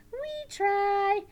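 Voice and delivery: high, loud puppet voice; eager puppet. Pretend. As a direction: pipe up as a keen puppet pupil. Puppet answers teacher.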